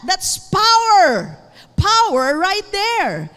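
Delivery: loud, high, drawn-out proclamation; preaching exclamation. Real, not acted. Preacher exclaims over the power of God's word, emphatic shout. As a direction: punch it out to the congregation, slowly.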